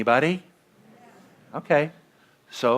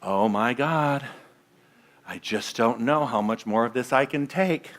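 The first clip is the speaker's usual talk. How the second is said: weary despair; 3 semitones lower, 6 dB quieter than usual. Retold or imitated. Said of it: "I'm guilty of this going".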